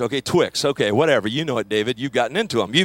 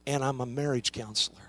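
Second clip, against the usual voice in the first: retold sob; 7 semitones lower, 11 dB quieter than usual. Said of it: "he started to bust out crying".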